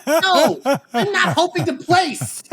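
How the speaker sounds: high, drawn-out exasperated yell; losing it. Retold or imitated. First-timer answering the German rival, mock outraged yell. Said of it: "I just lost it".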